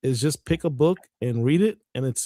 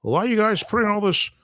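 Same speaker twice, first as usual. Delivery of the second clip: 1 mock critic; 9 semitones higher than usual. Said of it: "there was a lot of talk in the community".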